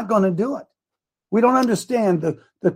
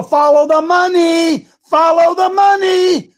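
high, loud, slow preached cry; emphatic shout; 9 semitones higher, 6 dB louder, slower than usual. Real, not acted. Preacher to viewers, repeating the slogan loudly. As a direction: drive it home slowly, shouting.

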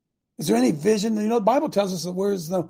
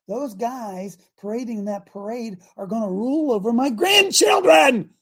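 building to outraged shout; 4 semitones higher, 5 dB louder than usual. Real, not acted. Preacher warning about his grandchildren's future, building to an outraged shout. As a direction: start near normal, end in a high roar.

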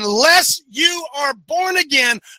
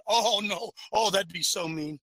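ordinary talk, nothing marked

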